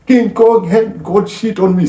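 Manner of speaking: high, loud movie-rant impression; Denzel Washington. Quoting Training Day to show her rage, loud defiant rant. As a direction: bellow it like a defiant film boss. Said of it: "have you guys seen Training Day, Denzel Washington?".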